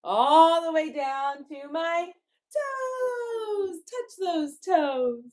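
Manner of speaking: high, teasing stretch down to the toes; playful sing-song. Acted. Teacher has children touch their toes, high sing-song. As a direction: climb high on a long drawn word.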